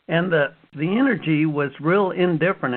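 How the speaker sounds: ordinary delivery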